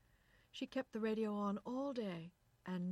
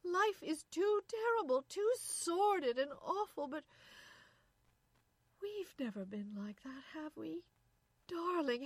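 high, loud desperate pleading; crying; 10 semitones higher, 8 dB louder than usual. A narrator's reading. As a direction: plead tearfully for reassurance, halting at times. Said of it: "she cried".

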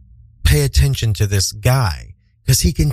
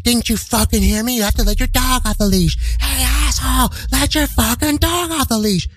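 high, strained mock yell of a ranting stranger; yelling maniac; 9 semitones higher than usual. Irate stranger shouting at a dog owner, mock high yelling. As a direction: imitate an irate heckler, pitched way up without real volume. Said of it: "He's still yelling like a maniac".

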